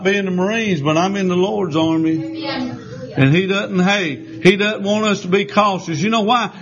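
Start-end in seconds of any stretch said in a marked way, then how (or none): none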